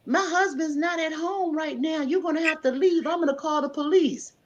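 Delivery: a hint of a nervous wife at the door; wife's voice.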